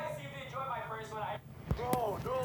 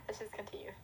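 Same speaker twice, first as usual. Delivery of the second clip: whisper; 2 semitones lower, 7 dB quieter than usual. Real, not acted. Moving on after anxious aside.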